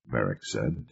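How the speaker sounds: plain reading voice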